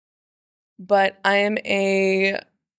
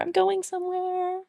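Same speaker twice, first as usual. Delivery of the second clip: high, softer, airy I'm going somewhere; dreamy sing-song; 10 semitones higher, 6 dB quieter than usual. Pretend. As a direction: float the words like an excited traveller. Repeats the line, trying voices.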